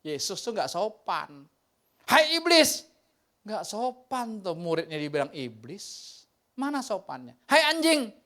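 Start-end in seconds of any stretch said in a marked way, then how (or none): 2.1-2.9 high, loud sharp rebuke; rebuking shout. Retold or imitated. Jesus rebuking his disciple as devil, shouting. 7.5-8.2 high, loud sharp rebuke; rebuking shout. Retold or imitated. Jesus rebuking his disciple as devil, shouting.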